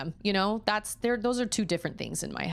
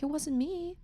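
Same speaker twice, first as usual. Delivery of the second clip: high small denial; little boy's denial; 9 semitones higher, 3 dB quieter than usual. Retold or imitated. Auden insisting he didn't crack the iPad, in a small child voice. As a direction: deny it like a little kid.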